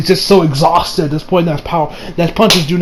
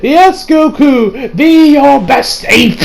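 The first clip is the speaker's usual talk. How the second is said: higher, very slow sneering Frieza voice; mocking Frieza; 6 semitones higher, much slower than usual. Frieza taunting Goku, high and drawn out. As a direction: stretch each word out in a sly, taunting way. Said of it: "Frieza says".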